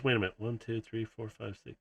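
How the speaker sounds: very quiet count under the breath; counting to oneself. Acted. Kiara counting the little bots, muttered to herself.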